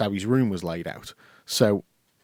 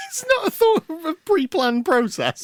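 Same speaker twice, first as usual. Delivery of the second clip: laughing; 5 dB louder than usual. Real, not acted. Laughing mock protest.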